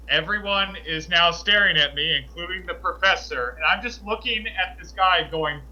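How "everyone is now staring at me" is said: ordinary delivery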